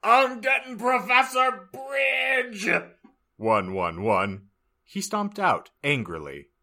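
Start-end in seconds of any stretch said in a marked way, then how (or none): 0.0-2.7 high, loud furious yell; angry shout. Acted. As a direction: yell it out in a rage. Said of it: "shooted angrily".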